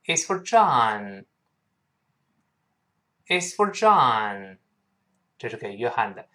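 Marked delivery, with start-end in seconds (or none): 0.0-4.6 slow answer with a drawn-out "John"; recitation model. Shown as a demonstration. Teacher leads students reciting the answer, slow model reading. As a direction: read it out slowly for repetition. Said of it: "我们大声来背".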